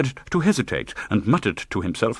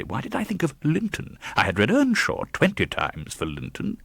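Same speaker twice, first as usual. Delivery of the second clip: plain reading voice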